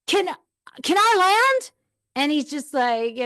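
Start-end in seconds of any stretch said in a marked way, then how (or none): 0.1-1.7 imitated exasperated plea, high and loud; frustrated husband. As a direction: cut in, pitched way up, pleading for a breather.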